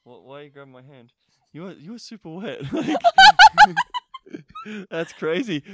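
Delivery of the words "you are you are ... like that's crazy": high voice breaking into loud laughter; laughing. Real, not acted. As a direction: crack up as you tease. Teasing remark dissolving into laughter.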